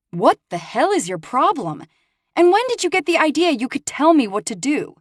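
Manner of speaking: heated, high-pitched challenge; angry. Acted. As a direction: snap back in anger. Arguing with Dante.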